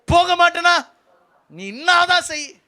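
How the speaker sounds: high, loud mock refusal, slowing at the end; hammed-up obstinate shout; stubborn defiance. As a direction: imitate someone daring the other to do their worst. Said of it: "வைராக்கியமா இருப்ப".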